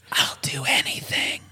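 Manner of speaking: forceful stage whisper like a ghost; imitated ghost whisper; ghostly whisper. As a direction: whisper it as a spooky EVP. Voices option as the ghost.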